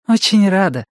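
high-pitched, lifted, smiling voice; smiling greeting. A narrator's reading. Карин greets Сага with a hug, smiling and high. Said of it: "улыбнулась Карин".

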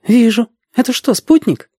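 put-on very high-pitched girl's question; curious girl. A narrator's reading. Girl character asks about moving spot. (любопытная девочка, высокий голос)

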